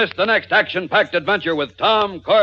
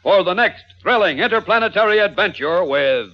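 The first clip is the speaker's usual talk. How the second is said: radio announcer. Acted. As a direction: sell the next episode boldly. Announcer's closing promo.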